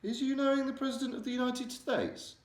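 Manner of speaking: father in awe asking his son; high, hushed respectful question. Acted. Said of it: "in a rather respectful voice".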